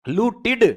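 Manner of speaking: लूटिड with a short i before the final d; teaching pronunciation. Shown as a demonstration. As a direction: say लूटिड as loot-id, ending in id. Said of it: "ई की मात्रा जुड़ेगी ना भाई".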